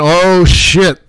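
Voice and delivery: loud, drawn-out oh shit; loud slow exclamation. Real, not acted. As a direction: stretch it out loud as disaster hits. Market collapse exclamation.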